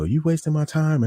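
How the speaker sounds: high-pitched retold complaint; mimicked angry customer. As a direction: imitate a customer going off at the manager. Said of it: "she's just, like, going off".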